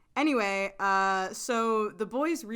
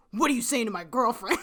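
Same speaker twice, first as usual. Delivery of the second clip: quick, louder protective challenge; mock confrontational; 6 dB louder, faster than usual. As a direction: imitate a boyfriend storming in. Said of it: "he storms over and he's like".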